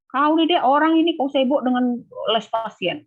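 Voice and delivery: a staff nurse snapping at a student; scolding head nurse. Retold or imitated. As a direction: imitate an irritated senior nurse. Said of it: "Nah, kena marah".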